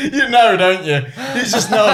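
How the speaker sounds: high, laughing all through; cracking up at the shared joke; real helpless laughter. Real, not acted. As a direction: squeeze the words out between laughs.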